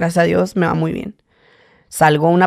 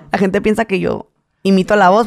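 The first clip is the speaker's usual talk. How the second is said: usual speaking voice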